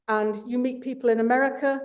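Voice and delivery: ordinary talk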